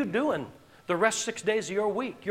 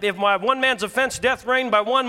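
normal talking voice

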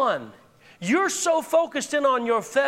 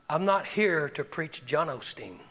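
calm firm conviction; 5 semitones lower, slower than usual. Retold or imitated. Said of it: "the Lord spoke through him and said".

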